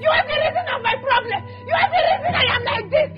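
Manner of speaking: crying and yelling at her brother; tearful shouting. Acted. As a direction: sob and shout her refusal. Woman keeps shouting through tears.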